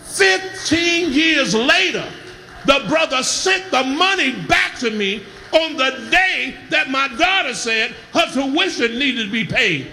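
usual speaking voice

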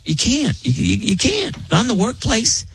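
high, insistent scolding; indignant. Real, not acted. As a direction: drive the point home. Scolding workplace PDA.

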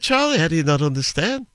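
high, swooping Scouse lilt; sing-song Liverpool accent. Shown as a demonstration. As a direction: make Liverpool speech sing up and down. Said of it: "It's just a bit of a sing-song".

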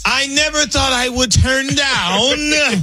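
high, slow, drawn-out holler; mock party-anthem yell; playful hype shout. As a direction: stretch it out like a hype man. Playful hype voice answering the host.

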